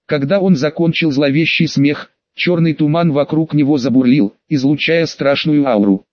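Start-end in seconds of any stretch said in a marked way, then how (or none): none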